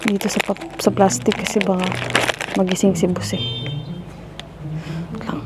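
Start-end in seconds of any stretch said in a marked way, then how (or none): 5.1-5.4 unvoiced whisper; whisper, careful not to wake him; quiet at night. Real, not acted. Afraid boss wakes.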